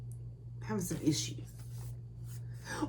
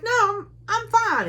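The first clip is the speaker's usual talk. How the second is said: much higher, slightly louder insistence; heroine insisting; 3 dB louder than usual. Retold or imitated. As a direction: squeak out a stubborn denial. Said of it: "And she's like".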